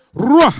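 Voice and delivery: Ruach shouted with a strong trilled R; loud rolled R. Shown as a demonstration. Preacher leading the crowd, shouted rolled R. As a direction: call out Ruach, R rolling. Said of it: "Vamos a decir".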